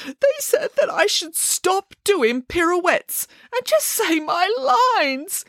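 high, slow weepy bear voice; weeping. Acted. Bear repeating the crew's insult, weeping high and slow. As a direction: push the words out through tears. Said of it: "wept Boris".

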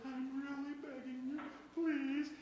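high, soft wheedling please; mock weak begging. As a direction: plead meekly, like a weak beggar. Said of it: "It's not just".

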